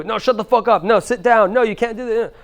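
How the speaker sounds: high-pitched mock scolding; angry parent. Parents reacting to a child's tantrum, mock-furious. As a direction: act out a parent badgering a kid. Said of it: "A lot of our parents".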